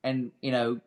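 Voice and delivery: usual speaking voice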